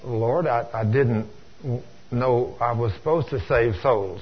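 low, halting, sheepish answer; sheepish admission. Acted. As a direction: hesitate like someone caught unprepared before the boss. Sinner answering God in story.

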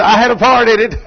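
loud, high, eager boast; eager claim. Retold or imitated. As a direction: jump in to grab the credit. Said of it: "Everybody jumps up and says".

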